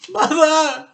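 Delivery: very high, very loud wail; Missus Cranky wailing. A narrator's reading. Missus Cranky wails at her mother. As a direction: wail it in horror. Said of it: "wailed Missus Cranky".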